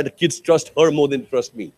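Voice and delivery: ordinary delivery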